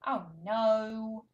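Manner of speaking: high, animated picture-book voice with big gasps; reading to a toddler. Shown as a demonstration. Speech therapist reading to toddler, animated and sing-song. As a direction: read it like a thrilling show for a baby.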